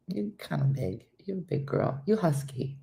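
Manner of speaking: soft, affectionate aside to the dog; soft talk to dog. Real, not acted. Owner talks to her husky dog, soft pet talk. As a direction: softly tease a pet.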